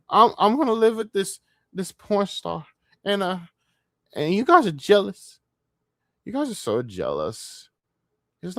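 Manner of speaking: a light mock of a bragging man; mocking Ralph. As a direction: sneer as the boastful guy. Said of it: "Like, no, Ralph".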